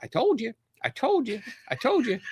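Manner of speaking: mock elderly friend crowing; imitating the friend. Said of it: "her friend is next to her going".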